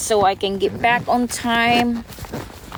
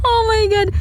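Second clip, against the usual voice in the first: high squeal through laughter; can't stop laughing; 10 semitones higher than usual. Real, not acted. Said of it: "you making me laugh".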